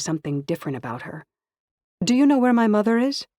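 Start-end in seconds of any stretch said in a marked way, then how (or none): 2.0-3.3 anxious. Acted. Anxious question about mother.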